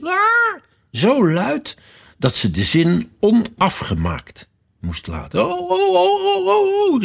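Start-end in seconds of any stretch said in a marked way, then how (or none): 0.0-0.6 very high-pitched scream; shrieking. A narrator's reading. The queen interrupts Alice with a scream, shrieking. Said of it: "toen de koningin begon te gillen". 5.4-7.0 string of very high squealed "oh"s; high-pitched wailing cry. A narrator's reading. The queen shakes her hand, wailing in squeals. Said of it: "riep de koningin".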